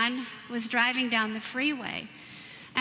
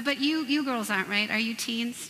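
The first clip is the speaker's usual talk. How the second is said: ordinary delivery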